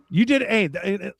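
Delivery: ordinary talk, nothing marked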